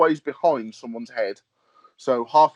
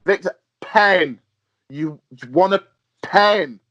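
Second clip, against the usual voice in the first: louder and slower, each word spelled out like to someone with no English; slow loud talking to a foreigner; 6 semitones higher, 6 dB louder, slower than usual. Retold or imitated. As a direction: talk loud and slow so the Russian gets it. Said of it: "one of the other guys turns around and goes".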